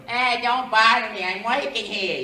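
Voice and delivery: put-on gruff, shouted Brooklyn accent, pitched high; Brooklyn Italian plumber. Plumber telling someone to leave him be, loud gruff Brooklyn accent. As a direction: holler like an irritated Brooklyn plumber. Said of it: "Italian plumber from Brooklyn".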